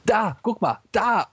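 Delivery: high, excited pointing-out; hectic cry. A narrator's reading. Marx showing Engels his new racetrack, crying out hectically. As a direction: cry out like an impatient child. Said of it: "hektisch, ... schrie".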